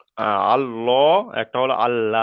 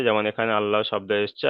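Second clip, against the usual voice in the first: normal talking voice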